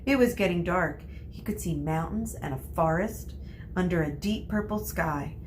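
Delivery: ordinary delivery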